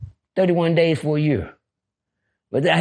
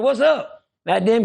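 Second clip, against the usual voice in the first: normal talking voice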